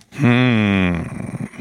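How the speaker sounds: drawn-out grumbling hum; murrend. A narrator's reading. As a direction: grumble it wordlessly, displeased. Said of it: "murrte Don Camillo". (unwillig gebrummtes Murren)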